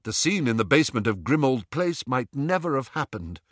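ordinary delivery

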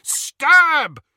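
very high, full-force shout. A narrator's reading. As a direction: shout the order so it echoes. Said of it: "shouted Harry".

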